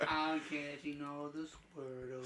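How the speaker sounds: silly slow voice. Pretend. Playful mock voice.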